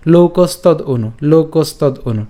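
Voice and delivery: slow, linked "লোকস তদ অনু", no inner pause; joined up. Shown as a demonstration. Teacher repeats it for practice, run together. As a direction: drill "লোকস তদ অনু" as one word. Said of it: "আমরা একসাথে উচ্চারণ করতে পারি".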